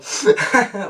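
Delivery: short burst of mock sniffles; imitated sniffling. Reviewer imitating the crowd in tears, mock sniffling. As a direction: sniffle like a tearful audience. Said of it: "you can hear everybody sniffle".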